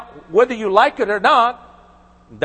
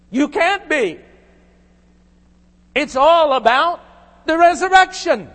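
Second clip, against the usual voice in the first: raised, higher-pitched preaching push; 7 semitones higher than usual. Acted. Pastor pressing the doctrine of resurrection on listeners, preaching hard. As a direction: drive the point home to the congregation.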